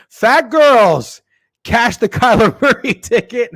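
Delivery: high, loud, laughing celebration; excited shout. Real, not acted. Streamer celebrating a cashed bet, laughing loudly. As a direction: crow about the winning ticket.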